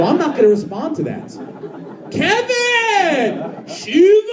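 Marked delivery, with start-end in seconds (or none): 2.1-4.3 high, stretched-out fan hollers; mock bellow with long held vowels; imitated yell. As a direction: mimic a drunk guy calling out from afar. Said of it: "Why are people yelling".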